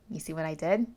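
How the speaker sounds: ordinary delivery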